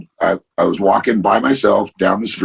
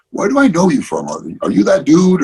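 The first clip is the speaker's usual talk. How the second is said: imitating a curious fan; 8 semitones higher than usual. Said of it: "somebody comes up like".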